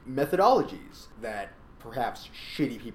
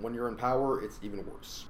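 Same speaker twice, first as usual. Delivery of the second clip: normal talking voice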